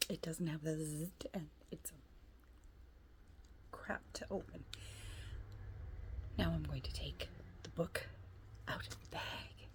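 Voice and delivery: soft whisper while unboxing. Real, not acted. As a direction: hush it like ASMR.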